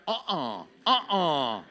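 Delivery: low, reluctant no-way grunts; acted refusal. James refusing Peter on the water, reluctant refusal. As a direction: refuse firmly, playing it for comedy.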